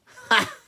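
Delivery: short, very high, loud laugh; mocking lion's laugh. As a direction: scoff with a burst of laughter. Lion scoffs.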